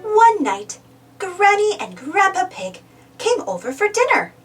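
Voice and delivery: ordinary delivery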